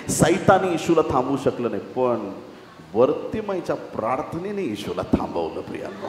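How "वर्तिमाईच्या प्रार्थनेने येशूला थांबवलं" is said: usual speaking voice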